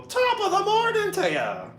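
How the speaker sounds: very high, loud mock-Irish cry. Shown as a demonstration. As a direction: shout it as a hammed-up stage Irishman. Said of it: "it's the usual".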